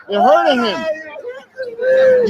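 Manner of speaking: high, slow anguished cry; crying out in distress. Real, not acted. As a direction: wail it out, pleading. Cop hurting friend, crying out.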